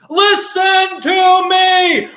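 high, loud, drawn-out yell; acted emphatic shout. Peter making sure readers listen, acted emphatic shout. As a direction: shout it slowly, stretching each word. Voices Peter demanding attention.

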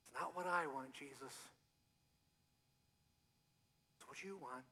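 soft surrendering prayer; quiet prayer. Acted. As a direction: pray it under your breath.

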